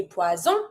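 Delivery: "poison" in French, "pwa-zon" with Z; French Z sound. Shown as a demonstration. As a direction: buzz the S of "poison" as Z. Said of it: "it means poison".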